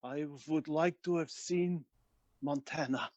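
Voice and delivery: put-on slow, deliberate Russian-accented delivery; measured movie-Russian, word by word. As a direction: savour the words in a Russian accent. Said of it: "with a better Russian accent".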